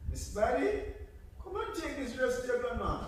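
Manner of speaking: mother calling. Acted. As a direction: send her off with the dress. Mother sends Buddy.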